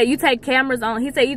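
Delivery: normal talking voice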